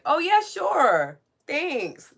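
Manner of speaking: high, drawn-out, loud fake gratitude; slow singsong sarcastic delight; mock eager acceptance. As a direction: accept it with hammed-up enthusiasm. Mock acceptance of an awful offer.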